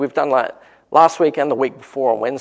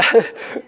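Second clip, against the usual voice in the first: short breathy laugh; 10 semitones higher, 5 dB louder than usual. Real, not acted. After saying fifty bucks.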